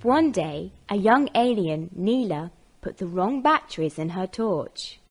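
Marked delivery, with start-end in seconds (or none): none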